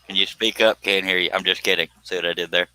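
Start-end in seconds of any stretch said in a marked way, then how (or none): none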